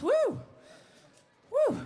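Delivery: high, loud whoop of joy; excited whoop. Real, not acted. Singer whooping to the crowd after a song, high excited cheer. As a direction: let out a real stage cheer.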